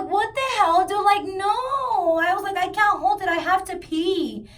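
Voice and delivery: high, loud and exasperated; loud outburst. Retold or imitated. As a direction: yell it in disbelief. Retold outburst at friend.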